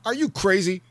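high, loud mock disbelief; general's incredulous retort. General snapping back at a soldier, high incredulous mock retort. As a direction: fire it back like a stunned general. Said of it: "his delivery is nuts to me".

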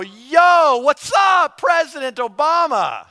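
loud, high casual shout; mock-cocky yell at a head of state; brash greeting. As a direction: barge in yelling like old pals. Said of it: "I'm not gonna walk in and go".

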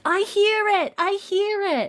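put-on very high-pitched toddler voice; imitated three-year-old, squeaky high; Jemmy. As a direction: pipe up like an excited little child. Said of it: "and then Jemmy's like".

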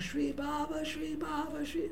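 high, hushed imitation of yelling; mock crowd yelling. Club crowd turning on him, softly mimicked high-pitched yelling. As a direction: mimic a far-off crowd chant, high and small. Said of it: "yelling at the top of their lungs".

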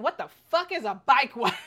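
loud, laughing What the fuck; laughing outrage. Retold or imitated. Confronting her husband about the bike wife, loud and laughing. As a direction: confront him while laughing.

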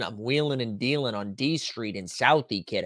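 put-on a light Boston tough-guy accent; hint of a Boston accent. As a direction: play it like a Southie local, lightly. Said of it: "what accent is that?".

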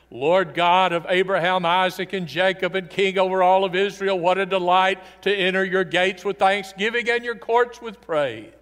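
booming, exultant psalm of praise; grand praise. Retold or imitated. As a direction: proclaim it like a king entering the gates. Triumphant psalm versus honest lament.

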